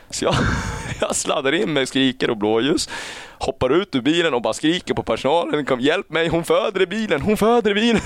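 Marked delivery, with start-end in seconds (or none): 5.7-8.0 retold scream. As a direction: yell at the hospital staff in panic. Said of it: "skriker jag".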